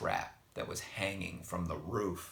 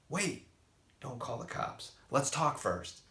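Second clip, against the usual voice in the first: urgent plea; 8 semitones higher, 3 dB louder than usual. A narrator's reading. Father stops the call.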